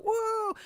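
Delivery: very high imitated "woo"; high, drawn-out mock cheer; crowd whoop.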